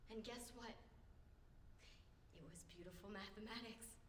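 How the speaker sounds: low, very soft voice; hushed revelation. Acted. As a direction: drop right down for the reveal. Acted bitter revelation, sudden drop.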